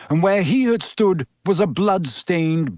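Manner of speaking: ordinary delivery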